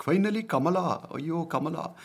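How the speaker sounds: very high-pitched, excited caricature; mock Indian American. Comedian mimicking Indian Americans cheering for Kamala, high mock excitement. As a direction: squeal it like an overexcited fan. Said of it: "Indian Americans are like".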